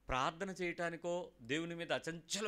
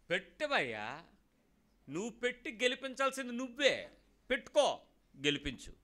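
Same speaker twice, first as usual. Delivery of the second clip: defiant shout; 6 semitones higher, 3 dB louder than usual. Acted. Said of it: "defiant challenge to trials".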